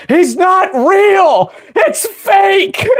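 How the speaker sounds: very high-pitched squealed shout; mock screaming. Sister screams at a mall Santa, mock screaming. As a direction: imitate a furious little kid screaming. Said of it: "she started screaming out".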